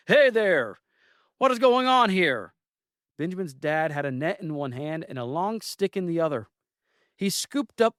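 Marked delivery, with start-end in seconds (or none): 0.1-2.5 high and louder, demanding shout; booming yell. Acted. Benjamin's father bursts through the woods, loud demanding yell.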